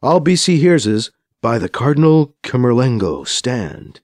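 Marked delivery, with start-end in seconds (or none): none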